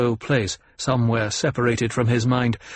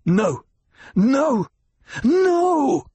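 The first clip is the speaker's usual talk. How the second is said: escalating cries, climbing far higher; anguished cry; 13 semitones higher, 3 dB louder, much slower than usual. A narrator's reading. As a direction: build from protest to a drawn-out wail. Boy in breakdown, pounding floor.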